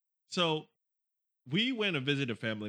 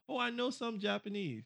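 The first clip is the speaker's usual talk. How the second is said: high-pitched imitation of a show-off; mock bragging; 8 semitones higher, 3 dB quieter than usual. Speaker imitating the guy who sits here bragging, mock boastful. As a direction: put on a braggy higher voice. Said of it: "start bragging about".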